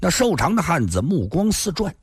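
usual speaking voice

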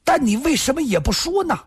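high, fast accusing question; stern, sharp demand. A narrator's reading. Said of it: "易明堂厉声地说道".

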